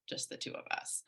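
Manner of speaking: just the two of us, dropped to a near whisper; hushed repeat. Real, not acted. To the listener, recalling the trip, hushed near whisper. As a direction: breathe just the two of us, voiceless and soft. Repeats the phrase with bitter emphasis.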